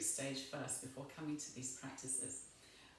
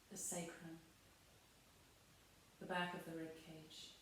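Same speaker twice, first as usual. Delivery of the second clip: guided meditation; 9 dB quieter than usual. Real, not acted. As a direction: guide them gently down into body and breath. Guides body awareness and breathing.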